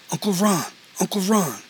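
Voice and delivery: put-on a boy bursting to tell his uncle; lightly voiced excited boy; excited child. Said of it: "almost too excited to get it out".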